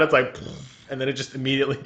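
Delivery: ordinary delivery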